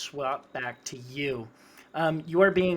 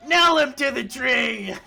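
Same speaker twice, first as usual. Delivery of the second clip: high, loud command; raised-pitch execution cry; shouted goblin order; 9 semitones higher, 8 dB louder than usual. Acted. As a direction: shout it out to rouse the mob.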